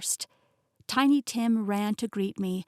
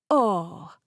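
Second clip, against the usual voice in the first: dramatic French chef; 5 dB louder than usual. A narrator's reading. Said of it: "Pierre dramatically placed the back".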